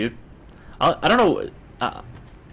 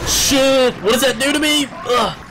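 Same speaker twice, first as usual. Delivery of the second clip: high, loud startled cry; panicked yelp; 11 semitones higher, 3 dB louder, slower than usual. Real, not acted. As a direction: yelp in alarm at the attack. Panic during a game fight.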